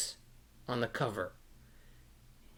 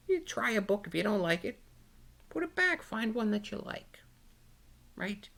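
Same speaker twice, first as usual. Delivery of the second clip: gentle advice; 9 semitones higher, faster than usual. Real, not acted. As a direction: coax the little ones kindly. Gently advising the children.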